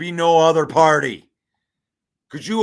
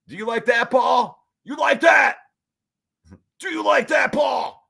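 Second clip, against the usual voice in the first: excited shout; 5 semitones higher, 3 dB louder than usual. Real, not acted. Hyping a chat commenter.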